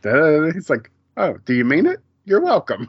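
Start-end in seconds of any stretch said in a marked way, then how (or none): 1.2-2.9 high, pleased imitation of taking thanks at face value; lilting, hammed-up gracious reply; mock sincere delight. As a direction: play him touched, pitch climbing into "welcome". Acting out his pleased, oblivious reply.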